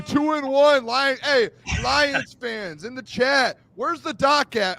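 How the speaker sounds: high-pitched, rowdy celebration; real overexcited hype; worked up. Real, not acted. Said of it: "I'm getting worked up".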